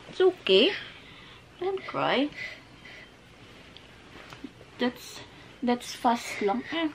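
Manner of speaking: high cooing comfort with rising ends; high, lilting reassurance. Real, not acted. Parent soothing a crying child.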